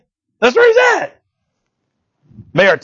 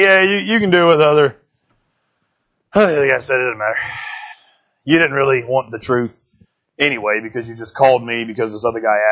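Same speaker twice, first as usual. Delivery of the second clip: sarcastic, insincere tone; 8 semitones lower, 4 dB quieter than usual. Retold or imitated. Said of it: "one of those tones right now".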